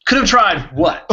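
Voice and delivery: high, loud mock disbelief; bewildered, throw the question up high; baffled outburst, voice jumping up; incredulous. Mock exasperation at cryptic line.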